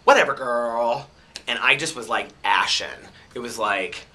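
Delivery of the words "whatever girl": lightly mocking imitation, drawn out and louder; mocking accent imitation. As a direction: draw it out like a teasing imitation. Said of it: "imitate it for you".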